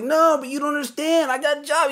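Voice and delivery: very high squeaky protest; pleading kid. Retold or imitated. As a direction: whine that you've got a job. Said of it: "And I was like".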